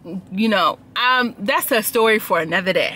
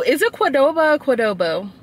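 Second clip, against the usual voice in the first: ordinary talk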